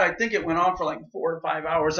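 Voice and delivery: normal talking voice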